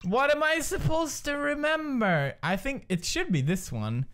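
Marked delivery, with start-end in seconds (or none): 0.0-2.4 exasperated wail. Real, not acted. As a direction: wail it in helpless bafflement. Baffled by quiz's memory question.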